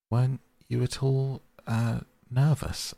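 tentatively ask, "nervous" rising at the end; anxious. A narrator's reading. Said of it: "some of yesterday's terror coming back to him".

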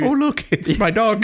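high, slow mock kiddie-show voice; singsong cartoon-host parody; children's cartoon presenter. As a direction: talk to a small child, exaggerated. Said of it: "Talks to you like you're a fucking child watching a cartoon".

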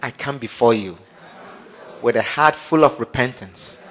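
low, soft prayer voice; leading prayer. Acted. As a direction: lead them quietly, phrase by phrase. Leads congregation in prayer.

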